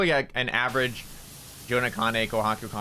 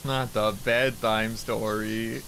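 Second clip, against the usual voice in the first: slow dramatic; 3 semitones lower, much slower than usual. Pretend. Mock dramatic reaction.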